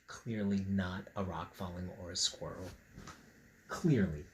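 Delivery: low, soft deadpan "Clearly not"; dry quiet aside. Real, not acted. Reader undercutting the book's fake innocence, soft deadpan. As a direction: drop the voice and deadpan the correction.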